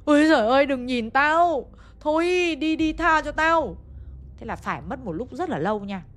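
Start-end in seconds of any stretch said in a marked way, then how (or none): 0.1-0.7 high, much louder scream; delirious scream. Retold or imitated. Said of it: "liên tục chu chéo thét lên". 0.7-3.8 high, slow begging cry; terrified pleading. Retold or imitated. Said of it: "liên tục chu chéo thét lên".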